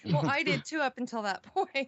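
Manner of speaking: light laugh through the words. Real, not acted. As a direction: chuckle while saying it. Laughing about liking Smurfs.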